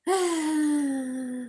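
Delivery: loud, high exhaled sigh; long heaved sigh of exasperation; exasperated sigh. Real, not acted. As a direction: let out a big, loud sigh. Exasperated about being sued.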